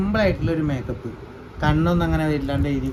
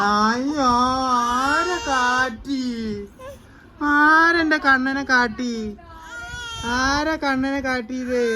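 higher, slow, a touch of put-on crying; mock sympathy; 8 semitones higher, much slower than usual. Mother comforting her crying son with mock sorrow. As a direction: whimper along with the baby, pretending to be hurt for him.

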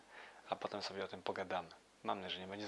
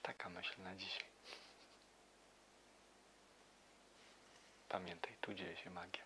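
quiet at night; 10 dB quieter than usual. Real, not acted. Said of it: "Już wszyscy śpią".

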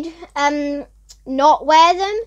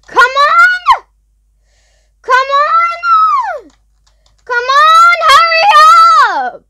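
high loud shouts of come on; panicked screeching urging; frantic urging; 12 semitones higher, 7 dB louder, slower than usual. Real, not acted. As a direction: yell at the game to hurry. Racing to finish a task.

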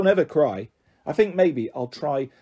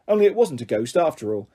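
plain reading voice

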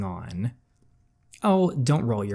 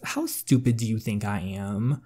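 usual speaking voice

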